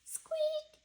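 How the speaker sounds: tiny high squeak; squeaky mouse noise. Acted. Reading to kids, a tiny high squeak.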